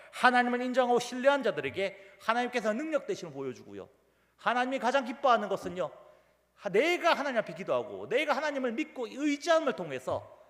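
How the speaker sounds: high, loud, driving sermon delivery; forceful preaching. Acted. Preacher urging believers to pray and trust, forceful. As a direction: press each phrase on the congregation.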